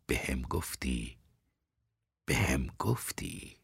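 very soft, slow reassurance; calm him with a hushed, drawn-out answer; gentle and subdued; soothing. A narrator's reading. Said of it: "برای اینکه آرامش کنم".

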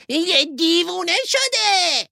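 high-pitched alarmed cry; young wolf's shout. A narrator's reading. Young wolf sees Hilo near the flock, high shout. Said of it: "فریاد زد".

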